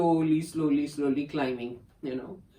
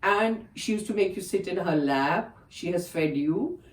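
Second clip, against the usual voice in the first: ordinary talk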